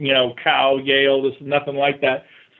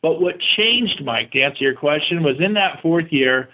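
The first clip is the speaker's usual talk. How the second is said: ordinary delivery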